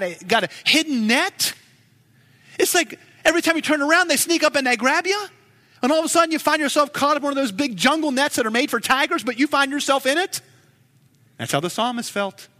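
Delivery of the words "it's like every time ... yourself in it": fast, high and animated; excited storytelling. Acted. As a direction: rush it like a sudden trap. Vivid net story, sped up.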